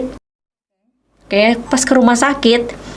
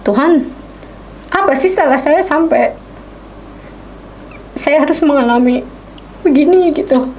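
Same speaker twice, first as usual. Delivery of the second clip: higher, pleading protest rising in pitch; complaining to God; 6 semitones higher than usual. Retold or imitated. Wife protesting to God about her sick husband. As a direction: cry out to God, hurt and angry. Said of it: "Saya sempat marah ke Tuhan sampai gini".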